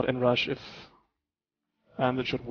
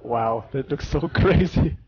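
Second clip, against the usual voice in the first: laughing; 5 dB louder than usual. Real, not acted. Amazed at crowded game.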